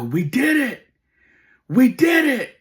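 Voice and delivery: very high, loud victory cry; triumphant shout. Retold or imitated. Dad celebrating the transfer with his son, triumphant shout. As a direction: yell it in celebration, building on the repeat.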